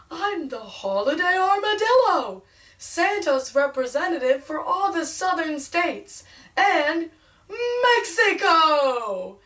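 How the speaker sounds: lightly put-on high, loud armadillo character, slowing at the end; a hint of a hammed-up mascot voice; holiday armadillo voice. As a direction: play a cheerful costumed mascot, drawing out the last word.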